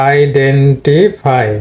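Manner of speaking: Identify very slow, syllable by syllable; slow model word. Shown as a demonstration. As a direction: stretch identify out for learners. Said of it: "এটা হলো". (Identify খুব ধীরে)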